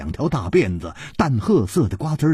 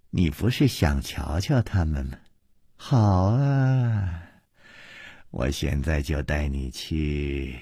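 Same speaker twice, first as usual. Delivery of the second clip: soft, low purr after a chuckle; chuckle, then offer it softly and teasingly; sly amusement; 7 semitones lower, 5 dB quieter than usual. A narrator's reading. Miss Ping offers to lead the prisoner to his friends, amused. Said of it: "忽然笑着说".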